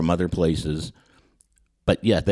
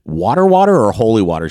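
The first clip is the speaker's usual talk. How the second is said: loud incredulous question; 2 semitones higher, 8 dB louder than usual. Retold or imitated. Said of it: "I said".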